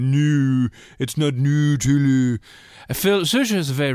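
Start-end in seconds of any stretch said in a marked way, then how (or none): 0.1-2.4 slow, stretched-out Nutella with long oo vowels; mock-French Nutella, every vowel dragged to oo; drawn-out mock Nutella. Reading Phil's message about Nutella, mock drawn-out oo vowels. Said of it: "Phil sent in a big long".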